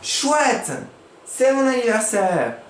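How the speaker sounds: high, delighted shout; joyful exclaim. Shown as a demonstration. Teacher showing a happy exclamation to pupils. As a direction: squeal with birthday joy. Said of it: "Il va crier".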